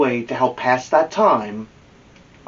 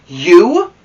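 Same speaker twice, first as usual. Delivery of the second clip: higher, louder disbelieving "You?"; surprised; 6 semitones higher, 9 dB louder than usual. Acted. Baseball boys doubt Amelia Bedelia can play, surprised and louder. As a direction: boys can't believe it.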